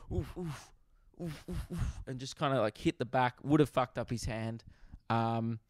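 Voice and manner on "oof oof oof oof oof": soft, airy repeated "oof"; imitated punching noises. Homeless guy punching at a passing Lamborghini, softly puffed oofs. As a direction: puff out quick, quiet punch sounds. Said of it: "was like".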